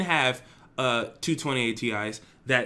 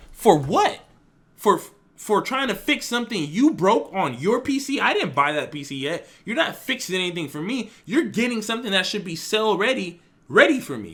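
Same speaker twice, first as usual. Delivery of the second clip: loud, higher indignant comeback; heated retort; 7 semitones higher, 4 dB louder than usual. Retold or imitated. To the repairman who overcharged him, a heated indignant retort. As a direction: push back hard at a rip-off.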